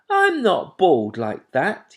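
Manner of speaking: high, loud, slow protest; offended little owl; indignant owlet. A narrator's reading. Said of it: "he said indignantly".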